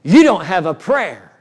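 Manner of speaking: retold devil's voice. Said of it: "the voice said".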